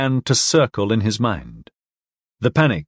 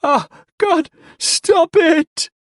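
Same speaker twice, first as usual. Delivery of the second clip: much higher, louder pleading scream; terrified wail; 4 dB louder, much slower than usual. Acted. Drunk begging his attacker, terrified wail. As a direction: shriek in pain and terror. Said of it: "Platt wailed".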